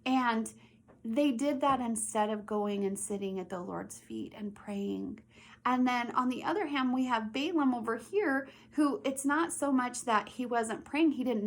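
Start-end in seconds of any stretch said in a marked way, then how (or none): 1.8-5.2 hushed whisper for effect. Acted. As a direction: drop to a whisper to draw the kids in. Teacher dramatizes the lesson.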